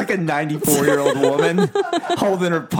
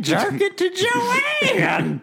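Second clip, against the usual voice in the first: high, very slow hillbilly shout; hillbilly accent; much slower than usual. Retold or imitated. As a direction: stretch it out in a hillbilly drawl. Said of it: "Was this your inner tractor guy?".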